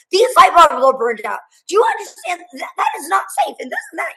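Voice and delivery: high-pitched, lightly hammed-up nagging; mock scolding sister. Retelling her sister's lecture to the interviewer, high mock scolding. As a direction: play the bossy sister lecturing about safety, pitch climbing.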